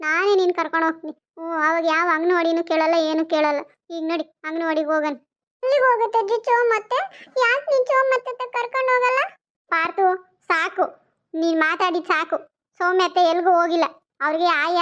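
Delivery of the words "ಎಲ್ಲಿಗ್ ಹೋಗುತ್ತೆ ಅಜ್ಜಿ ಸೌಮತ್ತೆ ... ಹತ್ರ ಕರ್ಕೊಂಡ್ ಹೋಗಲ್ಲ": put-on high, childish whine; whiny little boy. Little boy whining to grandma about school, high childish voice. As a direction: play a small boy refusing to go.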